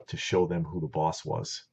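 ordinary delivery

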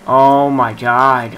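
loud, slow drawn-out groan of words; frustrated outburst. Real, not acted. Gamer yelling at his screen in frustration, loud and drawn out. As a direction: let it burst out, dragged long.

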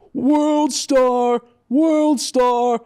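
loud, high, drawn-out shout; imitated holler. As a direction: holler it out like a heckler with a phone. Retelling guy shouting while recording.

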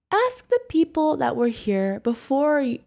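normal talking voice

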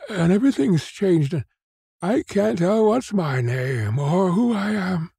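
put-on lost old man's distraught outburst; at wit's end. A narrator's reading. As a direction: work up from confusion to a high, helpless wail. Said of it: "at his wit's end".

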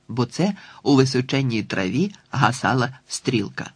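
plain reading voice

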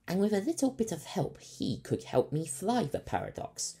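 a captain thinking aloud about his pilot; Captain Fryce. A narrator's reading. Captain musing about his new pilot, lightly voiced.

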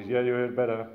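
gossiping aside. Pretend. Churchgoer now gossiping.

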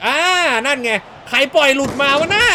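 loud, high-pitched outburst at conceding; exasperated shout. Real, not acted. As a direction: yell in real dismay at the goal. Conceding a goal in game. (ตะโกนหัวเสียเสียงสูง)

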